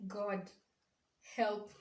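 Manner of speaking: usual speaking voice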